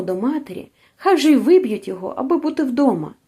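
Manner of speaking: ordinary delivery, nothing marked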